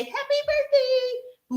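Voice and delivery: high, slow, bored happy birthday; ordinary people. Retold or imitated. Mocking the ordinary feed greeting, slow and high. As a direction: drag it out high like a dull greeting. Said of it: "I don't just go in the feed and say".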